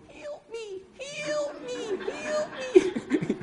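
very high, tiny pleading squeal; tiny trapped ant. Acted. Little ant begging the boy for rescue, tiny high plea. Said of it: "that little ant looking up at me and going".

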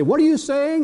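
lightly voiced wife asking; husband imitating his wife catching him talking to himself; wife's voice.

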